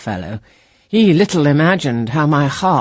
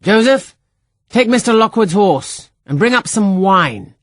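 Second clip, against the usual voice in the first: higher, louder call across the court; 8 semitones higher, 5 dB louder than usual. A narrator's reading. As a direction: summon the old servant, loud and high.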